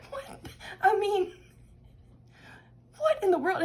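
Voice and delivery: high, incredulous what in the world; laughing disbelief at the cards; amused disbelief. Real, not acted. Reacting to the reading in high amused disbelief.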